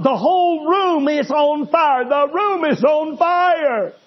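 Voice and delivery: a dying man crying out in panic to his wife; panicked dying man. Retold or imitated. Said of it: "where he was screaming about".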